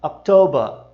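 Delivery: October ending in /ɐ/ with no R; October britânico; British October. Shown as a demonstration. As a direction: drop the R of October. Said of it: "Já o britânico costuma dizer October".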